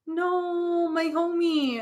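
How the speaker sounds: high, drawn-out mourning "no"; playful lament. Real, not acted. Reader sad for a departed homie, lamenting.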